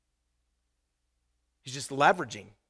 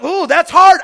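mock whine; 13 semitones higher, 20 dB louder, slower than usual. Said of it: "some of you are like".

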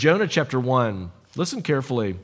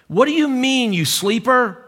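high, raised-voice challenge; yelling over a storm; 8 semitones higher than usual. Acted. Captain rousing a sleeper in a raging storm, yelling. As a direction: call out sharply above raging weather. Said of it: "imagine him yelling over the raging storm".

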